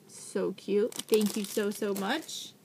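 ordinary talk, nothing marked

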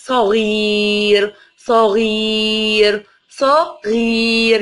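صغير stretched very slowly, long ي held; slow reading together. Shown as a demonstration. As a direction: read صغير slowly with the class. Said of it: "نقرا الكلمه مع بعض".

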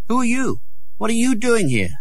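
high voiced mock challenge; picture someone catching him; imagined challenge. A narrator's reading. Tom imagining a guard catching him, mock challenge.

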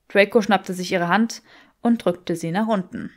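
usual speaking voice

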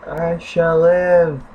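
slow, grand declaration; mock grandiose. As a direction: proclaim it like a hammy hero. Mock triumph after survival.

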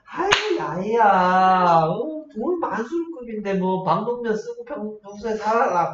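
high, drawn-out motherly wheedle; coaxing mother. Acted. Mother urging child to put up with a rich suitor, coaxing. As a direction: play a mother talking her child round, slowly. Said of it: "그래서 엄마가".

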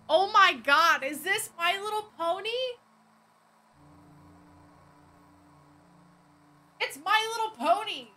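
loud, high gleeful disbelief; excited shouting. Real, not acted. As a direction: shout it, delighted and amazed. Recognizing My Little Pony.

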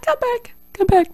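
very high, wailing Rose call; hammed-up tragic movie heroine calling out; Rose from Titanic. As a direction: wail it like Rose on the sinking ship. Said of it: "like Titanic when Rose is like".

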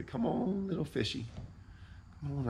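put-on high, soft coaxing baby talk; sweet-talking the fish. Angler coaxing a fish toward the bait, sweetly. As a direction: coax the fish like a pet, softly and high. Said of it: "I just talk to 'em really, really nicely".

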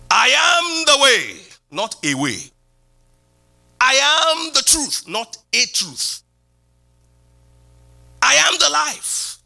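high, slow, stressed I am; proclaiming. Real, not acted. Preacher proclaiming Jesus' words to the church, high and slow. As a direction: lift each I am and stretch it out.

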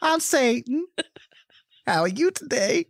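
high, sweet, unhurried hostess; grandma Satan. Retold or imitated. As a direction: welcome them warmly to the torture line. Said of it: "Tyler Perry as Satan".

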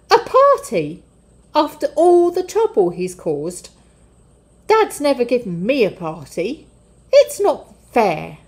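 put-on older brother protesting, stung and resentful; older son protesting his brother's party, indignant and loud; indignant outburst. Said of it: "He was very grumpy".

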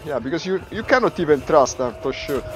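normal talking voice

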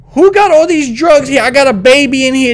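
high, loud indignant questioning; outraged parent. Pretend. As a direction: demand answers, alarmed for the baby. Alarmed parent finding drugs.

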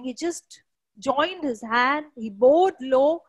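usual speaking voice

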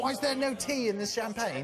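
mock-indignant English accent; American doing a comic English accent. Shown as a demonstration. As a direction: complain in a put-on English accent. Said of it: "Do your English one again".

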